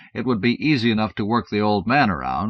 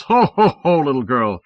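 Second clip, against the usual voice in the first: Santa greeting; 5 semitones higher, 7 dB louder than usual. Acted. Helpers greeting the kids.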